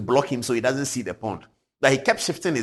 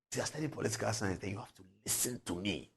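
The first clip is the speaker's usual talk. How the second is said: imitating Bobo; 13 dB quieter than usual. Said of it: "That's how he talks".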